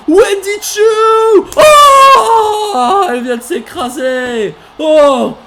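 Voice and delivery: very high, loud, drawn-out screams; screaming hype. Real, not acted. Commentator screaming as Wendy Choo crashes down, shrieking shout. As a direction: scream her name as she falls.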